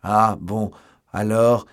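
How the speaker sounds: loud, deliberate call. A narrator's reading. Athos replies through the cellar door, calling loudly. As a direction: call out in short bursts.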